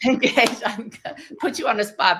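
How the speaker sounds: laughing through the word. Real, not acted. Laughing as the host teases cohost Patty. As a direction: chuckle as the answer lands.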